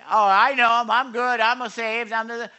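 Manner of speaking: loud, high, brash self-assurance; imitating someone loudly sure they're saved; mock complacent believer. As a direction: brush off concern with cocky certainty. Mocking a complacent professing believer.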